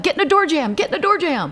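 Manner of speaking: high, loud repeated command; urgent order. Retold or imitated. Front desk telling quake-struck guests where to shelter, urgently. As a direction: imitate an urgent emergency instruction.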